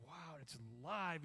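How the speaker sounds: slow, wondering Wow; awed wonder. Retold or imitated. Believer sensing God alive, slow awe. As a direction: let the Wow hang in amazement. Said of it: "And you go".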